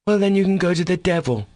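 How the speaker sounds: high-pitched, dismissive send-off; curt dismissal. A narrator's reading. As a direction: cut the conversation short scornfully. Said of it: "cutting short the conversation".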